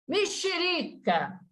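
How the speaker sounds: Mexerica said high and slow like a swear word; a playful fruit name in place of a swear word; mock curse with a fruit name. Shown as a demonstration. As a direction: let Mexerica out as a softened curse. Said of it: "você fala assim".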